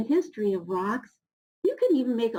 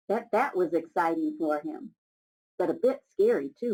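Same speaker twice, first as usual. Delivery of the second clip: ordinary delivery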